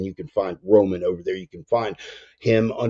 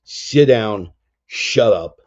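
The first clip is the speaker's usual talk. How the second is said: stern shout from God; 2 semitones higher, 7 dB louder, much slower than usual. Retold or imitated. God yelling at a ranting believer to sit down, loud. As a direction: snap them out of their rant. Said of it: "he smacks you in the back of the head and says".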